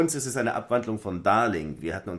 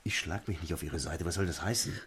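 whisper; 4 semitones lower, 5 dB quieter than usual. Acted. Flüsternder Ehestreit.

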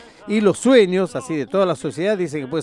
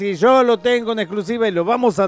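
louder and higher, rushing with hype; excited announcement; 6 semitones higher, 5 dB louder than usual. Real, not acted. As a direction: sell the big exclusive. Hyped exclusive announcement on air.

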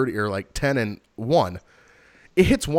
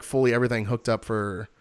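usual speaking voice

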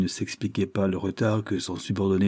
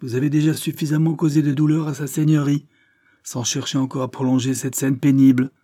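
irritable scolding in a raised pitch; quick-tempered; 6 semitones higher, 4 dB louder than usual. A narrator's reading. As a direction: rebuke him as an easily angered lawyer. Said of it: "porté à se mettre facilement en colère". (reproche irrité, voix plus aiguë)

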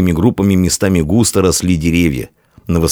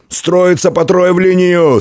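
high, drawn-out military order; shouted command; 13 semitones higher, 3 dB louder than usual. A narrator's reading. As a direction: order the men into formation. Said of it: "скомандовал Фока".

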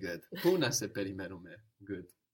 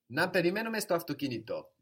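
quick, higher Να περιμένουμε, falling away on αυτοκίνητο; affirmative tone; 5 semitones higher, 4 dB louder, faster than usual. Shown as a demonstration. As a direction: propose Να περιμένουμε στο αυτοκίνητο briskly and decidedly, with a final fall. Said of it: "affirmative tone of voice".

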